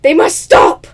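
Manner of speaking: loud, hammed-up order; playful dragon shout; mock dragon command. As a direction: bellow it to halt the fight. Dragon ordering fight stopped.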